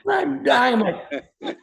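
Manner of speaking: cracking up so hard the words squeeze out; helpless laughter. Real, not acted. Laughing fit while telling a talk-show host the story.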